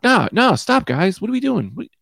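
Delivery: exasperated park shout; calling out to dogs. Retold or imitated. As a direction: yell to separate the dogs. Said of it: "I'm like".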